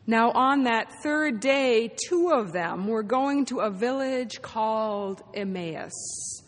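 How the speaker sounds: slow it right down so people can jump in; very slow gospel reading. Shown as a demonstration. Pastor reading the gospel to the congregation, very slowly. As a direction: read each phrase very slowly, leaving room. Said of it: "I'm going to read the gospel slowly, very slowly".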